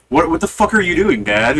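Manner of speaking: heated mock quote of yourself; pissed off. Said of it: "I didn't say that to him exactly the way I just did. I'm just pissed off".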